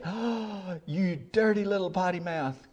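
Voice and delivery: high-pitched, exaggerated shocked gasp and scold; mock scolding. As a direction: pretend to be scandalized by a child. Mock scolding grandma never gave.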